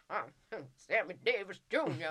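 mock possessed man puffing a cigar; cigar-smoking psychic. Retelling a psychic suddenly possessed by [name], cigar-puffing voice. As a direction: drag the name out round an imaginary cigar. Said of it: "pretends he's smoking a cigar".

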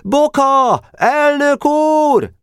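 high, drawn-out calling shout; shouting again. A narrator's reading. Boy urgently seeking his president, high calling shout. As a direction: holler for the leader, stretching the last word. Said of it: "Újra kiáltott".

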